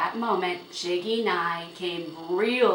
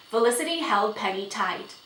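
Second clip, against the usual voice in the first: usual speaking voice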